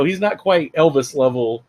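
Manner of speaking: usual speaking voice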